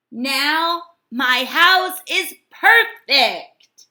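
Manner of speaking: loud, high, slow cheer. A narrator's reading. Hermit Crab cheers at his new wall, loud and slow. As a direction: cheer over the finished house. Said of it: "cheered Hermit Crab".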